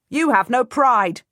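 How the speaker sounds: loud, high, spat scorn; spitting contempt. A narrator's reading. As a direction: spit the words at him. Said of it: "spits at him".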